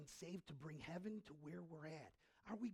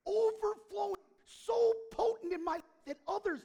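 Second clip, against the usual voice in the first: building to loud, high fervour; preaching climax; 9 semitones higher, 12 dB louder than usual. Acted. Preacher rousing the congregation, rising to a loud climax. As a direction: swell into full preaching force.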